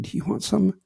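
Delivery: hushed cinema whisper, acted. Man answers his date during the movie, whispering. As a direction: whisper it discreetly to the person beside you.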